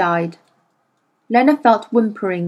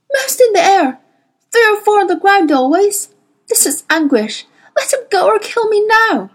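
high, loud tormented pleading; desperate wail under torture; anguished cry; 11 semitones higher, 5 dB louder than usual. A narrator's reading. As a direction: blurt it out in agony.

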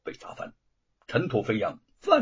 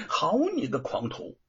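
angry; 6 semitones higher, 5 dB louder than usual. Acted. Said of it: "范谷生气地说".

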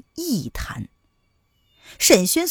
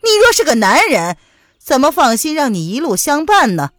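higher, louder stern reproach; stern rebuke; 5 semitones higher, 5 dB louder than usual. Acted. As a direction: come down hard on him. 微雨厉声训斥. (严厉地斥责)

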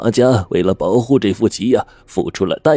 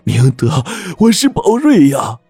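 much higher and louder, gushing with emotion; fervent, teary reunion plea; tearful affection; 9 semitones higher, 3 dB louder than usual. Acted. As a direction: pour out longing to an old friend. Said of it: "热泪盈眶地说".

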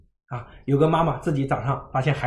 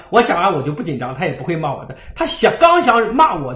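ordinary delivery

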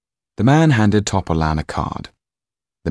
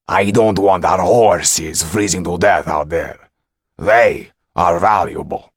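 loud, slow, weighty insistence; stern steward; 6 dB louder, slower than usual. A narrator's reading. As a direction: press the point as the impatient steward. Steward presses the magician.

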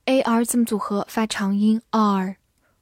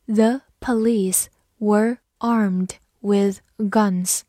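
very slow, word by word; slow reading; much slower than usual. Shown as a demonstration. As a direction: drag each word out for learners. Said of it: "好，慢读一遍。". (很慢地逐词朗读)

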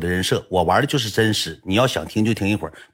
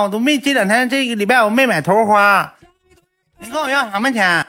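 put-on whiny kid refusing; 11 semitones higher than usual. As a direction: squeal the refusal like a stingy kid. Classmate refuses to hand money.

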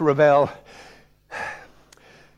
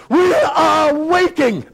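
very high, drawn-out impatient call; loud, sing-out schoolmaster demand; impatient shout; 13 semitones higher, 4 dB louder, slower than usual. Acted. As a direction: stretch it out as an exasperated headmaster. Headmaster impatiently summons pupil to read.

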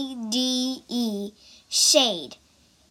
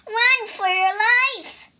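higher, louder warning shout; shouting rabbit; 9 semitones higher, 5 dB louder than usual. Acted. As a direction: yell a warning to everyone. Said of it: "shouted a rabbit".

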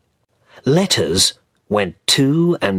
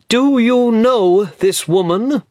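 voiced Mr Havisham; 13 semitones higher, 4 dB louder than usual. A narrator's reading. Lawyer questions Ben at hotel.

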